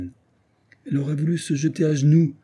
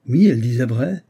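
exclaimed; 2 semitones higher, 6 dB louder than usual. A narrator's reading. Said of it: "s'écria Edward Arundel".

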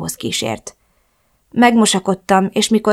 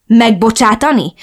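blunt retort; 4 semitones higher, 6 dB louder than usual. Acted. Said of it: "vágtam nyersen az arcába".

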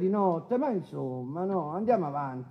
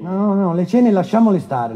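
loud, insistent no, no, no; firm loud cutoff; 3 semitones higher, 9 dB louder than usual. Real, not acted. Judge silencing the defendant about the dinners, loud and firm. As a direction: raise your voice to stop him cold.

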